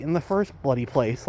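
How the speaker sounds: ordinary delivery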